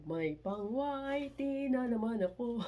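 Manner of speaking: playful lilting crow; gleeful singsong. Real, not acted. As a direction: sing out the good news with glee. Repeats line in gleeful singsong.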